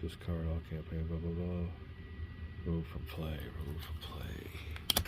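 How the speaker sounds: hushed voice. Real, not acted. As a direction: speak softly, well under full voice. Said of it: "We're able to, uh, speak full voice".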